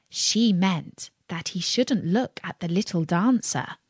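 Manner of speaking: ordinary delivery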